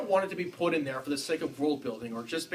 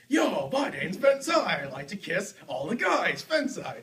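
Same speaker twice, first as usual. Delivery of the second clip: hammed-up Benzai; 4 semitones higher, slower than usual. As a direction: ham it up as Benzai introducing himself. Impersonating Benzai character.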